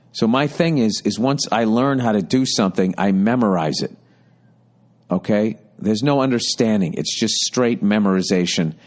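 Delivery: ordinary talk, nothing marked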